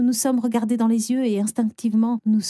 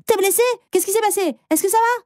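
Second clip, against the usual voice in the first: high, worried rush of questions; startled driver asking if she's hurt; alarmed concern; 10 semitones higher than usual. Acted. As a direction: check on someone you nearly hit. Near miss, driver checks on her.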